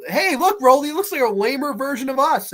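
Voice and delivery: high-pitched mock call to a friend; lightly hammed-up pointing-out; imitated movie character.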